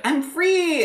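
high, loud squeal of freedom; mock cry of freedom. Teasing friend voicing freed students, high joyful squeal.

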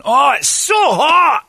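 high, loud, slow wail; mock anguished shout. Friend [name] on the heated seat, loud mock wail. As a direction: cry it out like you're burning. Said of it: "[name] shouting in hot seat".

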